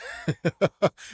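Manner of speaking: short light laugh; quick little laugh; amused chuckle. Real, not acted. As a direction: chuckle briefly at the joke. Amused by the quoted line.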